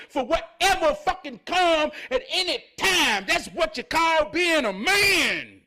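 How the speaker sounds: loud, rousing sermon voice; motivational preaching. Acted. Speaking to viewers about being ready, preaching. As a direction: fire up the listeners like a preacher.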